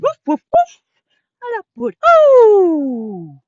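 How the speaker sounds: loud, very high woofs and oh; dog barking. Acted. Teacher barking in downward dog for kids. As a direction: yip like an excited puppy.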